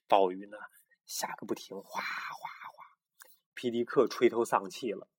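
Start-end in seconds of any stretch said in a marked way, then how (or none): 1.8-2.8 a soft whispered hwa hwa like pouring rain; whispered rain noise. Pretend. Mother telling a bedtime story mimics falling rain in a whisper. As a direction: breathe out the sound of rain for the child.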